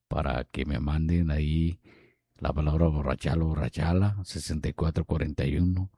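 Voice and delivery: very soft, low-pitched radio mumble; low murmur. Pretend. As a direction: keep talking way down low, barely audible. Sigue hablando bajito, en broma. (murmullo muy bajo y grave)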